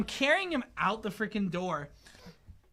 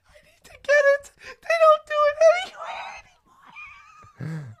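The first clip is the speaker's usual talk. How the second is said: high, loud quote broken by laughter; laughing quote; 8 dB louder, faster than usual. Retold or imitated. Quoting friend's urgent ice cream plea, laughing. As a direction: imitate her plea while laughing. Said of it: "she turns to me, she goes".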